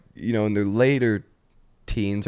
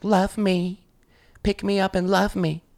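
high-pitched clingy pet pleading; needy pet; 9 semitones higher, slower than usual. Acted. Pet begging owner for attention, high needy voice. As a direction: beg for love in a high little voice.

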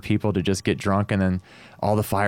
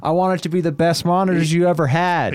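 higher, punchy pep-talk to the band; fired-up quote; 8 semitones higher than usual. Retold or imitated. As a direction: pump the musician up, half joking. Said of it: "And I go".

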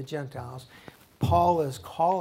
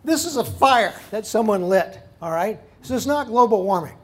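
loud, high scolding of the governor; preacher scolding a governor; imagined rebuke; 5 semitones higher, 9 dB louder than usual. Retold or imitated. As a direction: tell him off like he's lost his mind. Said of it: "my first instinct is to say to him".

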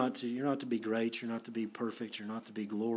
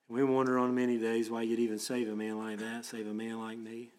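pastoral prayer; 4 dB louder than usual. Acted. Leads closing prayer.

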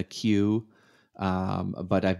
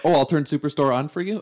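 higher and louder, overeager helper; mock eager offer; 8 semitones higher, 7 dB louder than usual. Mimicking Netflix eagerly offering Superstore to a viewer. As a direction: play the app that insists on helping.

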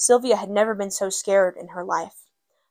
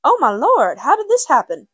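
higher, louder shocked cry; alarmed mother; 6 semitones higher, 6 dB louder than usual. A narrator's reading. Mother seeing her bleeding child, alarmed cry. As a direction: gasp it out in alarm. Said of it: "mother looked upset".

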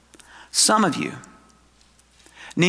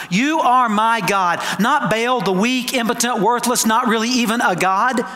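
emphatic preaching; 5 semitones higher than usual. Real, not acted. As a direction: preach it with conviction, stretching the big words. Preaches with rising force.

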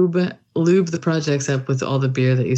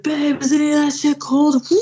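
higher, mock shout over machine noise; husband shouting over lathe; 12 semitones higher than usual. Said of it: "He's like".

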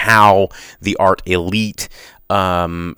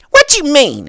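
shrill high screech of an angry customer; pterodactyl voice; 5 dB louder than usual. Retold or imitated. Imitating the angry customer demanding a refund, pterodactyl voice. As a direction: squawk it like a pterodactyl. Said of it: "pterodactyl voice".

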